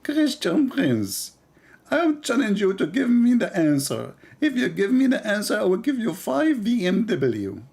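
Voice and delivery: high, slow preacher voice; Zakir Naik impression. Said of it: "I make the voice of Zakir Naik".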